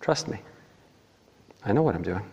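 soft, unruffled God; hushed, calm answer; God reassuring. Acted. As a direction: quietly insist on being trusted. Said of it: "And God says".

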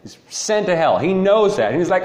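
ordinary talk

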